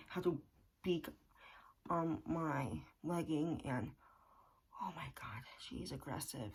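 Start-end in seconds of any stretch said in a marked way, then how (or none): 4.8-6.5 breathy whisper, exasperated. Real, not acted. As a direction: drop to a whisper in disbelief. Exasperated aside about the chicken.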